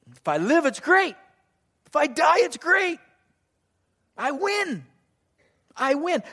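high, exultant win-win cry; triumphant declaration. Acted. Acts out Paul's win-win attitude.

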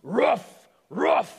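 loud barked roof; mock dog boom. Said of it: "the dog boomed".

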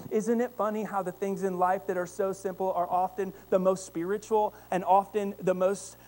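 usual speaking voice

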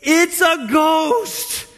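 high, drawn-out mock terror; terrified cry. Disciples seeing Jesus walking on the water, acted terror. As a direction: stretch it out as a hammed-up frightened cry. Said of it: "they were terrified and said".

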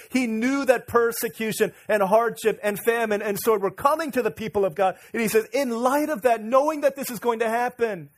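loud, high, forceful preaching; fervent preaching. Acted. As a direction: drive it home from the pulpit.

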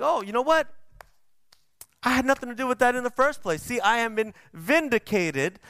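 high, fast, smug self-justifying; smug self-righteous. Retold or imitated. Believers after deliverance bragging they did nothing wrong, high and smug. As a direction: sound pleased with your own innocence.

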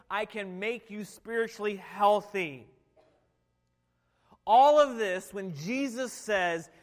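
preacher crying out to the congregation; preaching at full force. Acted. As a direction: build to a fervent, booming proclamation.

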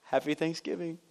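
quiet, sarcastic holiday greeting; retold sarcasm, kept soft. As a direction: wish it softly with mock cheer. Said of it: "probably sarcastically".

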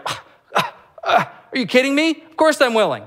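high, incredulous "are you kidding me"; mock-exasperated, as if the question is absurd; incredulous Jesus.

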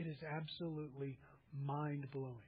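usual speaking voice

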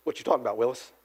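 low, soft mock sitcom catchphrase; eighties catchphrase. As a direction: drop the voice low and quote the catchphrase deadpan. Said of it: "to use another eighties catchphrase".

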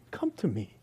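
soft, gentle call; tender invitation. Shown as a demonstration. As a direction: draw them close with a quiet voice. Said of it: "his tenderness as he invites you".

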